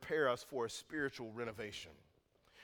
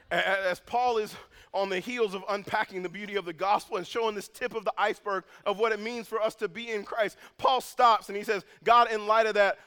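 higher and louder, pressing forward; fervent preaching; 7 semitones higher, 10 dB louder than usual. Acted. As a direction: build the moment up with urgency. Preaching at full force.

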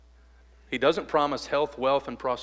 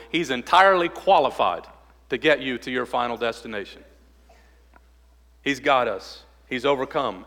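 louder, higher triumphant preaching; fervent preaching; 3 semitones higher, 4 dB louder than usual. Acted. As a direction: ring out the victory to the church. Preaching at full force.

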